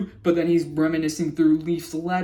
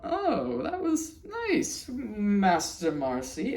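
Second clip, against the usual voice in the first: slow and fond, a rise on nice; amused King Andreas; 4 semitones higher, 4 dB quieter, slower than usual. Retold or imitated. King Andreas to Marcy, slow, fond and amused. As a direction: savour the moment, slowly. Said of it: "Andreas laughs".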